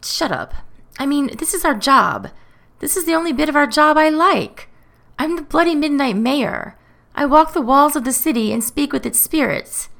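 high-pitched, self-important protest; irritable, indignant. A narrator's reading. Matthew defends his job as Mayor, indignant. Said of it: "Matthew shifts irritably on the spot".

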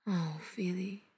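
a soft murmur. A narrator's reading. Mother comforting her son, murmured. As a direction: murmur his name gently. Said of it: "Dees murmurs".

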